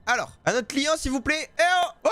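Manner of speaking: rising call for the next customer. Real, not acted. Seller summoning another customer, calling out. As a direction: holler to get someone's attention.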